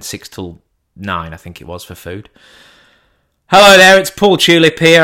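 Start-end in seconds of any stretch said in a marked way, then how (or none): 3.5-5.0 bright, higher, louder phone greeting; phone charm voice. Retold or imitated. To the restaurant on the phone, overly cheery greeting. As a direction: put on the polite caller act.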